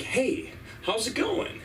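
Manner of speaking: high, casual greeting; friendly stranger. Retold or imitated. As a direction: imitate a stranger cheerfully saying hello. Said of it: "this homeless guy comes up".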